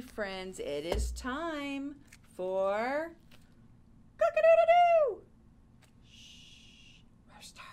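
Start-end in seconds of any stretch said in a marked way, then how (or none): 4.2-5.2 loud, high cock-a-doodle-doo; rooster crow. Pretend. Teacher imitates a rooster. 6.1-7.6 whispered shush to the rooster; hushing whisper. Pretend.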